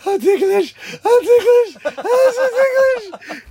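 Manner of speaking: high, loud squealing ticklish. Retold or imitated. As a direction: squeal like a man being tickled. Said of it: "he kept going".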